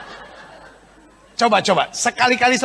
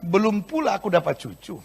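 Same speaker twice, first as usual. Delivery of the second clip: mock in-law complaint; 4 semitones lower, 5 dB quieter than usual. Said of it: "mertua begini kan".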